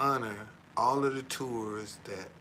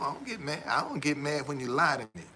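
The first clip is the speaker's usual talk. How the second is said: ordinary delivery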